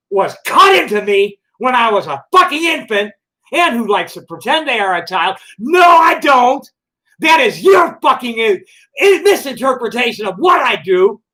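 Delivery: high, loud, slowed shouting denial; furious shouting. Real, not acted. Yelling back at an accuser, furious and loud. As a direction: roar the denial, outraged.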